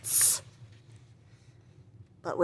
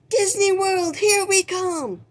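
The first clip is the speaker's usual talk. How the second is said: much higher, louder, slow cheer; joyful shout; 12 semitones higher, 4 dB louder than usual. Acted. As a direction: shout it like a holiday chant. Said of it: "they all shouted".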